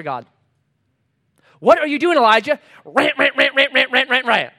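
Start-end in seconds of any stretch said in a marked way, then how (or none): 1.6-4.5 loud, high mimicked question into droning babble; mock rant. Preacher mimicking Elijah's ready-made answer, loud high mock rant. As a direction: parody someone launching straight into a rant.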